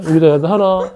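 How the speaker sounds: normal talking voice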